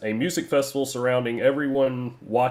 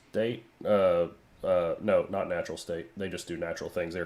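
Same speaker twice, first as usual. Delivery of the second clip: ordinary delivery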